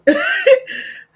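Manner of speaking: high, loud burst of laughter at herself. Real, not acted. Host laughing at her own slip on stream, loud and high. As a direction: crack up laughing.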